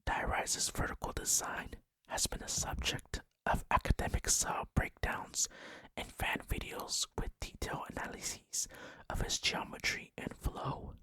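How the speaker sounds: ordinary delivery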